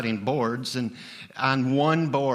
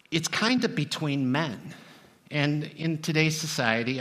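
ordinary talk, nothing marked

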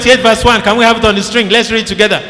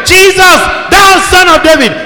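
crying out; 8 semitones higher, 4 dB louder than usual. A narrator's reading. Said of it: "Bartimaeus cried".

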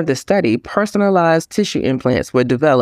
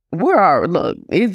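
ordinary talk

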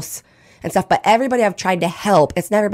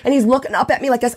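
ordinary delivery

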